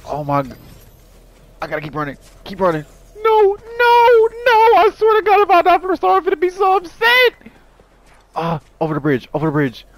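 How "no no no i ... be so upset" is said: very high, loud screeched protest; frantic high-pitched screaming; panicked wail. Real, not acted. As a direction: shriek it in desperate disbelief. May die from the storm.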